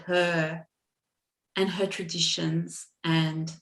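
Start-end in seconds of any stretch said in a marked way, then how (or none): none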